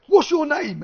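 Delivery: loud, high mock police demand; retold demand. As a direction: act out an officer confronting a prisoner. Acting police confronting cameraman in cell.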